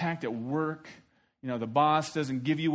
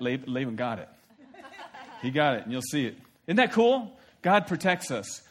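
ordinary talk, nothing marked

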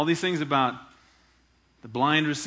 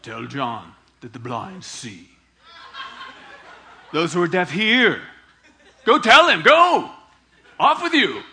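mad Jesus; 9 dB louder than usual. Pretend. Jesus sending John's disciples away, mock angry. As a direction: shoo them off in a mock huff. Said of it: "He's just mad".

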